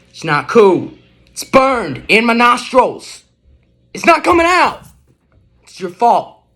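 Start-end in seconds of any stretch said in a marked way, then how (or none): 0.1-4.8 loud, high indignant outburst, rising up; outraged rant. Acted. Witness ranting to the detective about the smell, loud and high. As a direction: rage about the stench, pitch climbing.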